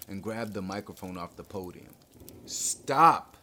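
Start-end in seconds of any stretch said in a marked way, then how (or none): 2.5-3.4 loud, high, commanding shout. A narrator's reading. Inspector on the microphone to a panicked hall, commanding. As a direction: order the whole crowd to halt. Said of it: "he commanded".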